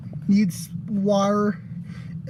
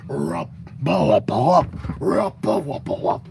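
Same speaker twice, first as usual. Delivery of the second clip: lower, gruff monster singing; 5 semitones lower than usual. Shown as a demonstration. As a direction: growl the little tune like a beast. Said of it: "in a gruff voice".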